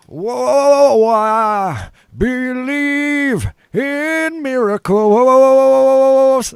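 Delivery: high, loud, drawn-out warbling croon; [name] impression. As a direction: belt it slow like a grunge singer. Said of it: "[name] did".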